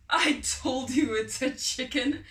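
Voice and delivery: loud, triumphant; gloating told-you-so. A narrator's reading. Farmer gloating loudly to his friend. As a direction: crow over him. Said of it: "roared with laughter".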